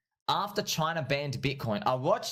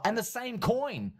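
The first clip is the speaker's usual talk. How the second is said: amazed; 10 semitones higher, slower than usual. Real, not acted. Amazed at coincidence.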